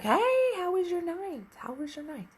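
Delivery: high, bright sing-song teasing greeting; eager mock greeting. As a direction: pitch it way up like a gleeful friend fishing for news. Teasing friend next morning, eager.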